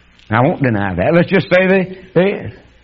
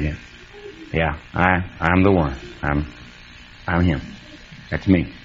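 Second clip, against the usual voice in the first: quiet answer; 9 semitones lower, 10 dB quieter than usual. Pretend. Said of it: "he said".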